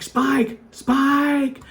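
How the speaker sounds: yelling the dog's name up the hill; calling out. Real, not acted. Owner yelling after his escaping dog, loud calls. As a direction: call out loud to a dog running away.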